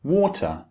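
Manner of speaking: "water" with a clear, distinct t; "water", high falling tone and a true t sound; British T. Shown as a demonstration. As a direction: say "water" the British way, crisp t in the middle. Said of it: "So in British English, we say".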